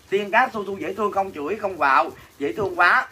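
ordinary talk, nothing marked

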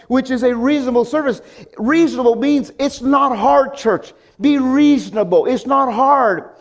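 high, ringing pulpit emphasis; fiery preaching. Acted. Pastor urging the church, fiery preaching.

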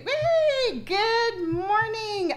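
high, drawn-out singsong good morning; stretched-out high morning greeting; cheery greeting. Real, not acted. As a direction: greet the stream with a bright sung hello. Livestream greeting, sung high.

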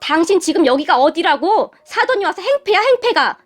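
high-pitched angry scolding; indignant yelling. Acted. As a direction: shout down an intruder, pitch climbing. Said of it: "저에게 소리를 질러댔어요". (높은 목소리로 호통치듯)

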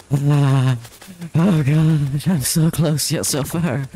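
high, strained panic, pleading for life; squealing in real game panic; panicked pleading. Real, not acted. Streamer panicking as game character nearly dies.

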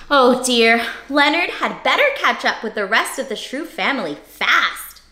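plain reading voice

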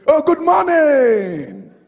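loud, slow, rising hello with a beaming lift; cheery greeting. Retold or imitated. Pastor imitating a stranger's cheerful greeting, loud and slow. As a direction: greet them like you're thrilled to see them, drawn out. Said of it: "They say".

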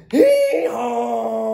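loud braying hee-haw like a donkey. Acted. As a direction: bray it out like a donkey. Donkey bray in read-aloud.

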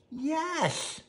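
Mooch's answer. Acted. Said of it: "said Mooch".

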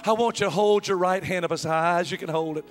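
preacher pressing home an appeal; altar call. Real, not acted. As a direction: call the congregation to respond.

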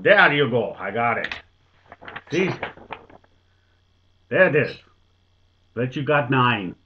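loud, proud announcement; proud old Dutchman bellowing his answer; triumphant Dutchman. Acted. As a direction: show it off like you've won. Dutchman triumphantly shows drawing.

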